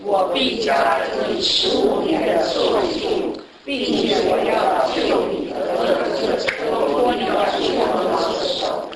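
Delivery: slow, solemn Bible reading voice; scripture reading. Acted. Preacher reading God's promise to Hezekiah, slow and solemn. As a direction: read God's words slowly and with weight.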